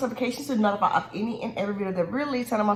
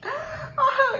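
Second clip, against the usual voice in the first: squealing high laugh. Real, not acted. Laughing at own moaning.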